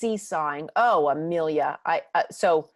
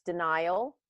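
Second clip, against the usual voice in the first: usual speaking voice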